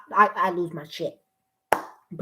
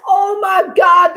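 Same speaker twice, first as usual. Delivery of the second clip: excited shout; 7 semitones higher, 5 dB louder than usual. Real, not acted. Excited reaction.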